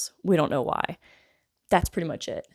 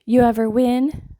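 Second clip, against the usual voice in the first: higher, louder sassy challenge; 6 semitones higher, 7 dB louder than usual. Retold or imitated. Doctor teasing a fighter about his record, sassy. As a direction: draw the question out with sass. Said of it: "Like, being sassy".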